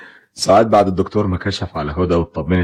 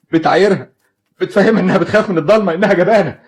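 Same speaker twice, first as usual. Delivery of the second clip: high, raised accusing voice; bitter anger; 11 semitones higher than usual. Acted. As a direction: lash out at her cruelty. Father accusing wife bitterly.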